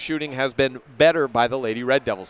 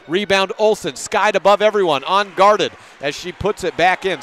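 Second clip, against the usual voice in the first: higher and louder play call; excited call; 4 semitones higher, 5 dB louder than usual. Real, not acted. Announcer calling a putback to listeners, excited. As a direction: ride the rebound up with rising energy.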